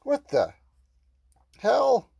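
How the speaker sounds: loud, high outraged yell; angry shout. Acted. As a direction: yell at him, betrayed and furious. Character angry at stolen gift.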